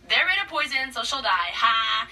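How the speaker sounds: loud triumphant villain gloat; gleeful villain. Retold or imitated. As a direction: crow over the plan, hammed up. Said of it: "she's like".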